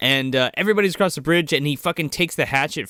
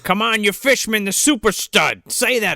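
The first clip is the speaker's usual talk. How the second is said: higher, emphatic coaxing; mock pep talk; 6 semitones higher than usual. Stern coaxing a cowardly kid, high-pitched mock pep talk. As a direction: pump him up, hammed-up.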